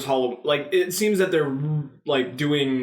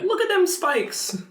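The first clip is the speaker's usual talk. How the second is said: much higher and slowed, squeaky; slower than usual. Real, not acted. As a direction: squeak it out slowly in surprise. Pointing at audio spikes.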